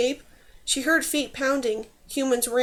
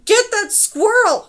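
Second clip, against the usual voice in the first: high, loud, drawn-out shriek; frantic villain's scream; 9 semitones higher, 9 dB louder, slower than usual. A narrator's reading. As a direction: shriek orders after an escaping foe. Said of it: "shrieked Rakosh".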